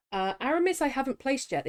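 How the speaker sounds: ordinary delivery, nothing marked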